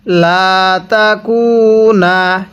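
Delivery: লা তাকুনা with the লা stretched one alif long; slow recitation of লা তাকুনা; long vowel drawn out. Shown as a demonstration. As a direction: draw out the লা, then তাকুনা slowly. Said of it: "কিভাবে পড়ব?".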